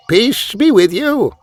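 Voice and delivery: put-on slow, high old man's greeting; disguised spy posing as an old shepherd, slow. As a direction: greet the soldiers humbly. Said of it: "said the shepherd".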